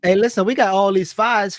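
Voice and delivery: usual speaking voice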